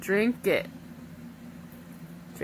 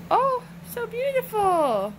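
high, drawn-out swoon; slow delighted coo, pitched way up; gushing admiration; 9 semitones higher than usual. Real, not acted. Parent admiring a toddler's glitter, gushing high.

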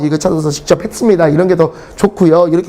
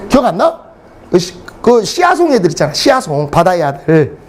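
usual speaking voice

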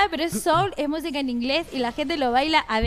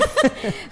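high burst of laughter; 13 semitones higher, 5 dB louder than usual. Real, not acted. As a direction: laugh off the teasing. Joke about speaking English.

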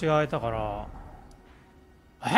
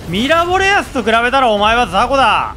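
loud taunt; 7 semitones higher, 8 dB louder than usual. Pretend. Taunting boss loudly.

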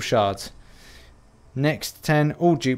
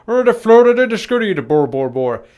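high, loud mock-Swedish gibberish; hammed-up Muppet chef babble, full throttle; 9 semitones higher, 8 dB louder than usual. Showing off his Swedish chef shirt, loud mock-Swedish babble. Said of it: "I don't see many Swedes actually speaking like that".

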